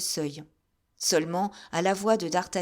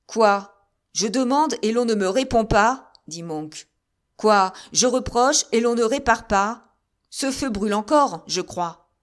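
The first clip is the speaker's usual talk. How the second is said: loud, sharp, higher reprimand; stern rebuke; 3 semitones higher, 7 dB louder than usual. A narrator's reading. General reprimanding soldiers who won't answer, stern. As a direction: bark out demands.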